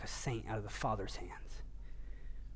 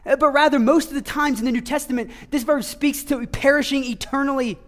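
higher and louder, emphatic; preacher insisting the sheep never perish, full force; preaching at full force; 9 semitones higher, 12 dB louder than usual. Acted. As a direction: press never and ever home.

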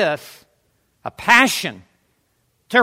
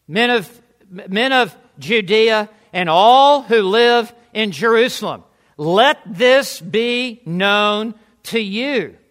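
slow, deliberate proclamation, every word distinct; commanding; slower than usual. Acted. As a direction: declare it firmly and clearly to a crowd. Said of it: "He, he is commanding".

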